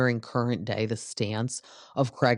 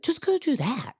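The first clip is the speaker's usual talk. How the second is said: high exasperated push; high fed-up telling off; 13 semitones higher than usual. Retold or imitated. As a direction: fling it high at Craig, fed up. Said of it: "So it's like".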